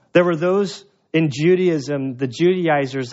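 usual speaking voice

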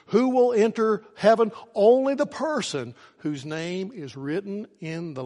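loud, high-pitched rhetorical questions and answers; full-force preaching. Real, not acted. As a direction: preach it out with rising fervour.

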